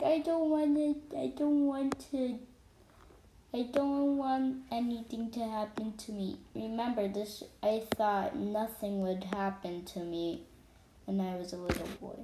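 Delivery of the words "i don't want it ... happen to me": put-on whiny crying kid. Boy imitating his crying younger self, tearful whine. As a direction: plead like a crying four-year-old. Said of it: "remember four-year-olds just cry".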